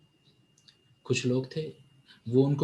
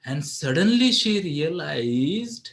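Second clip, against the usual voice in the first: ordinary talk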